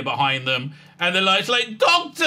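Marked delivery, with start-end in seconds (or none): 1.8-2.2 mock cry for help. Companions cry out.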